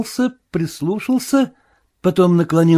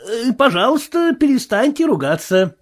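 high, hesitant plea; timid protest; 6 semitones higher than usual. A narrator's reading. Marvel asking the sailor to stop swearing, timid. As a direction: object meekly in a high voice.

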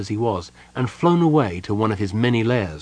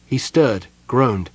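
plain reading voice

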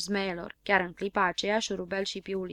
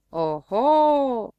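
long, loud oho of discovery; drawn out in surprise at the damage; surprised oho; 3 semitones higher, 7 dB louder than usual. Acted. Surprised under the car. (oho prelung, surprins)

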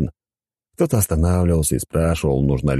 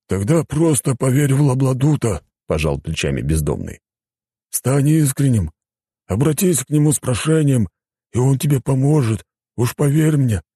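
higher-pitched character voice, coaxing; homeless adviser, persuasive; 9 semitones higher than usual. Acted. Homeless man advises desperate driver, high persuasive voice. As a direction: urge him to believe, pitched up.

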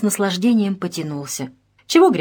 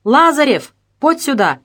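higher, louder summons down a corridor; calling out; 7 semitones higher, 5 dB louder than usual. A narrator's reading. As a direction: call a subordinate over. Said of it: "выглянул в коридор и позвал". (громко подзывая подчинённого)

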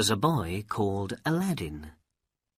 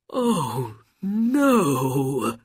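higher, drawn-out acted wail; grieving, near tears; 5 semitones higher, 5 dB louder than usual. A narrator's reading. Old man hearing his brother is dead, acted grief. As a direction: lament with a theatrical cry in the voice. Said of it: "and began to cry".

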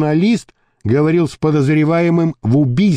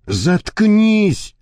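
snapped order; 7 semitones higher than usual. A narrator's reading. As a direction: cut him off with a sharp order. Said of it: "рявкнул на Рябова Гарик". (рявкнуть приказ)

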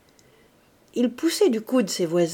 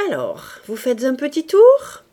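mock friendly; 6 semitones higher, 9 dB louder than usual. A narrator's reading. Mocking captain teases Mouret.

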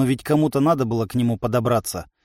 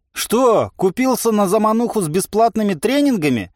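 higher, lively mocking question; playful raised-pitch jibe; cheerful teasing; 7 semitones higher than usual. A narrator's reading. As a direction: needle an opponent merrily. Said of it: "весело осведомился я".

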